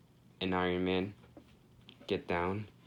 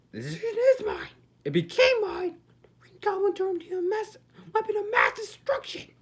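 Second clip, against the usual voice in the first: villain voice; 7 dB louder than usual. Retold or imitated. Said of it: "As Regime Batman says".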